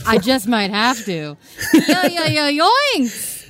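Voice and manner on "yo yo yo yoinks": high, loud laughing cry; giggling yoinks. Real, not acted. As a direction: blurt it out through laughter. Laughing exclamation.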